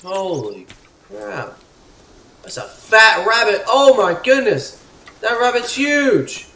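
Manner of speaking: high, drawn-out astonished yelling; slow, high-pitched awe at full volume; loud amazement. Real, not acted. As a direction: shout in disbelief at the sight. Amazed at a huge rabbit.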